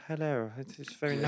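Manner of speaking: understated celebrity impression; Chris Eubank impression. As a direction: lightly do the boxer's odd voice. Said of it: "got this insane voice. Chris Eubanks here".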